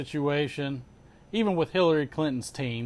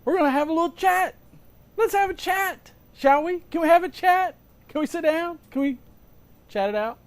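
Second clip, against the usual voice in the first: sing-song; 12 semitones higher than usual. Pretend. Playful sing-song chat invitation.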